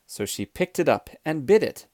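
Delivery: ordinary delivery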